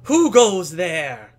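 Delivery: put-on high, loud child sentry, drawn out; little boy's challenge. Boy challenging a visitor at the farm, mock-stern child voice. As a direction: play a small boy bellowing a grand challenge. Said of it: "the boy stops laughing".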